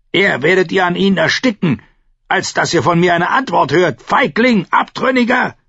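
high, loud abuse climbing to a peak; defiant shout. A narrator's reading. Captive refuses to answer Theon, shouting defiantly. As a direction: build to yelled name-calling.

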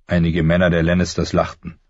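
plain reading voice

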